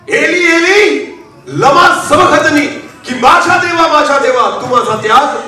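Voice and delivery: high, drawn-out acted lament; preacher re-enacting a slow, high cry. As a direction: stretch the call to God, pitched high. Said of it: "येशू एका गोष्टीमुळे ओरडला".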